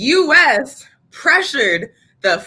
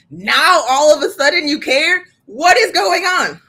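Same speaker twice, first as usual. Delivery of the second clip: incredulous exasperation; 6 semitones higher, 4 dB louder than usual. Real, not acted. Mocking sudden public concern.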